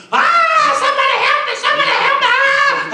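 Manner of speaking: very high falsetto screams for help; hammed-up shrieking damsel, full volume; caterwauling screams. Potiphar's wife falsely calling the servants, caterwauling screams. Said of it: "She started caterwauling and screaming".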